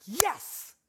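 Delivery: high-pitched, delighted "yes!"; gleeful yes. Retold or imitated. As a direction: cheer it like great news. Dad cheers son's boredom.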